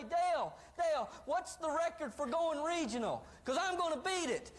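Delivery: high, excited acted phone call; fired up. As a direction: act an eager newcomer bursting with ambition. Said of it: "you're all fired up and excited".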